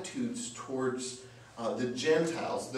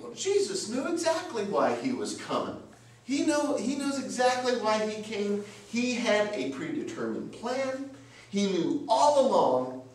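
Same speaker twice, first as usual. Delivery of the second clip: high-pitched, emphatic protest of certainty; acted indignant certainty, higher than usual; insistent defence; 8 semitones higher, 4 dB louder than usual. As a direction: voice a believer insisting, pitched well up. Voicing believers' defence of Jesus.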